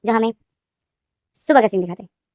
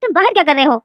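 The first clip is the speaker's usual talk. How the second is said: surprised mother; 7 semitones higher, 3 dB louder than usual. Acted. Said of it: "लेनदेन की माँ बोलती है".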